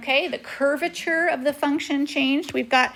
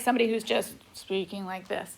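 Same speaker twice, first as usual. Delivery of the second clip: dull monotone, softer and lower; 3 semitones lower, 5 dB quieter than usual. Shown as a demonstration. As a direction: demonstrate droning with no inflection. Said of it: "Some monotone".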